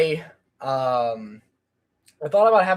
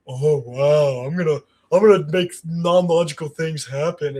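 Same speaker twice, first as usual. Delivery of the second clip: put-on voice of a hapless goofball, louder; deep character voice; 2 semitones higher, 5 dB louder than usual. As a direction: play the fool who gets into dumb situations. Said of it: "I wanted with this deep voice".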